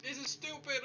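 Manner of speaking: high, off-mic frustrated yell; distant frustrated outburst; far from mic. Real, not acted. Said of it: "If I sound further away".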